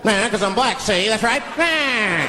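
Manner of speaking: put-on high old-movie gangster voice; forties bad guy. Driver answering police who pulled him over, forties gangster. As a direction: sass back like a forties movie crook. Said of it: "how bad guys used to talk".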